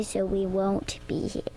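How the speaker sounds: soft unvoiced whisper. Real, not acted. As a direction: whisper it like a secret. Child drops to whisper.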